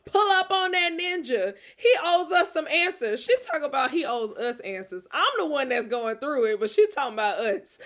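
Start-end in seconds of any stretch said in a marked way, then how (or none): 0.1-3.2 higher, louder, a hint of a bold hype friend; devil friend egging on. Devil-on-the-shoulder friend urging her to pull up, loud. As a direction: push her to go confront him. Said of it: "the devil".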